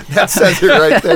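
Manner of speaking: laughing out the words, high and loud; bursting into laughter mid-line. Real, not acted. Host cracks up at the twin joke, laughing.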